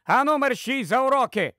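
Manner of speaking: high, sharp motherly order. Acted. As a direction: send the child off, voice lifted. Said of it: "Дора підвищила голос". (підвищений голос, суворий наказ)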